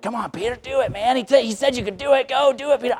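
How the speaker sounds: high, teasing urging of the crowd; egging disciples. Acted. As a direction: egg him on like buddies at the edge.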